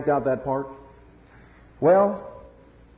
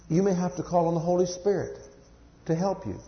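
normal talking voice

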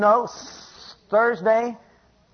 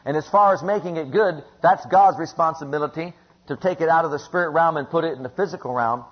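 ordinary talk, nothing marked

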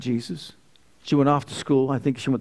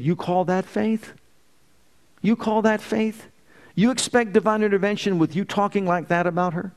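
challenging rebuke; 6 semitones higher than usual. Retold or imitated. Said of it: "He said".

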